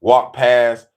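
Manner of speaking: ordinary talk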